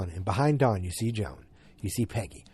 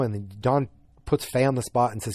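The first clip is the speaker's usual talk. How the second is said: usual speaking voice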